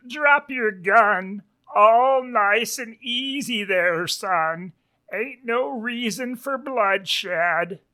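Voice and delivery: high, slow Western lawman drawl; sheriff pig. Acted. Sheriff orders young wolf to drop his gun, slow high drawl. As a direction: stretch it out high and easy, the leader in charge. Voices Brick Pig, clearly the leader.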